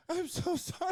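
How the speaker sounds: high mock apologetic girl voice; hammed-up high apology; imitating the girl. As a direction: squeak it out like a flustered young woman. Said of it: "and she's like".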